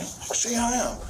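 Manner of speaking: strained high mimicry of someone in tears; imitated tearful challenge. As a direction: imitate a distraught, crying dare. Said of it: "like, crying".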